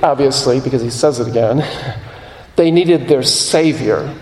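normal talking voice

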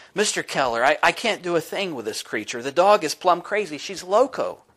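high-pitched desperate woman; excited lady. Acted. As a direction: voice a frantic dog owner. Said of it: "She began to talk excitedly".

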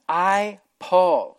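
I, Paul, said loud and firm; loud, emphatic self-naming. Retold or imitated. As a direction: plant your name with force. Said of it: "very emphatic".

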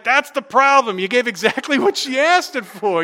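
high, loud retold retort; calling from another room. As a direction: imitate a wife calling through the house. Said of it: "my wife from the other room saying".

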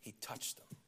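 hushed whisper; tender hushed reveal. Real, not acted. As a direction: drop to a whisper for the key line. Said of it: "You know what the Bible says".

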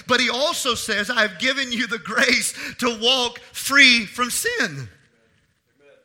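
loud, high, driving sermon delivery; impassioned preaching. Real, not acted. Preacher urging church to fear God, impassioned preaching. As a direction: preach it with full force and weight.

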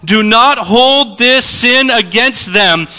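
high, loud and slow plea to heaven; loud cry. A narrator's reading. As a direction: cry it out slowly with full voice. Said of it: "he cries out with a loud voice".

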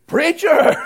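high, loud punchline shout; handle called out. Acted. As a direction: holler it like a radio call. Shouts his CB radio handle.

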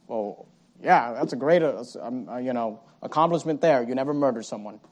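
low, soft sarcastic mock praise; sarcastic mumble. Pastor mocking a congregant's excuse, softly sarcastic.

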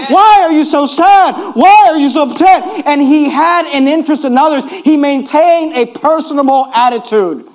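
full-force preaching. Acted. Pastor urging the congregation, shouted preaching.

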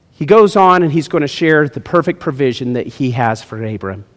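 ordinary talk

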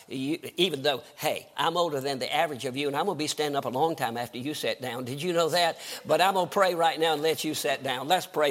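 ordinary delivery

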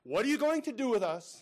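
high, loud alarmed demon voices; mock-alarmed demons. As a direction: cry out in fear of Jesus. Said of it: "worshiping him and saying".

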